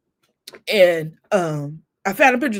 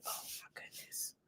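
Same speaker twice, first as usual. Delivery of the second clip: whispered under the breath; awed whisper; 28 dB quieter than usual. Retold or imitated. Mother holding her mother's letters, whispering in awe. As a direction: breathe it out in wonder.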